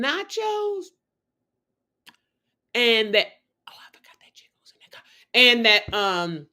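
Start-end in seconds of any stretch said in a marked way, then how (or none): 3.7-5.0 fast unvoiced whisper aside; a quick hushed confession to the audience. Real, not acted. Whispered aside mid-story.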